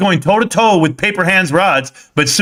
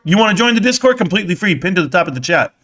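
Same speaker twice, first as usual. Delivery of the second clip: usual speaking voice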